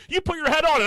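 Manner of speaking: high, loud mock-scolding voice; mocking girlfriend. Mimicking girlfriend scolding about the pillow, high mock voice. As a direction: whine it as the girlfriend. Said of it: "She's like".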